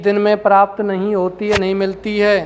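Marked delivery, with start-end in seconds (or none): none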